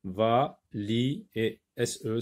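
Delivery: ordinary delivery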